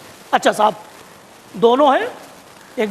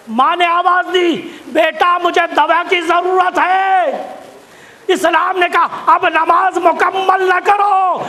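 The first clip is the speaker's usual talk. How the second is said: preaching at full force; 4 semitones higher, slower than usual. Real, not acted. Preacher to congregation, ordering prayer broken for the mother, shouted high and slow. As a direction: thunder the ruling at the congregation. Sermon climax on serving mother.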